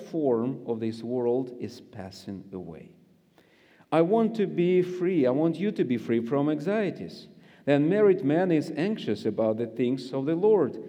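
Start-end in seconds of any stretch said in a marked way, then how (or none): none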